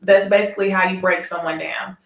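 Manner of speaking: ordinary delivery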